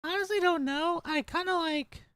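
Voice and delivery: put-on squeaky, overexcited little kid; annoying anime kid voice. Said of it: "This voice the whole time?".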